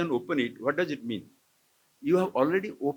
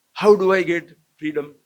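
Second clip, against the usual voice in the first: usual speaking voice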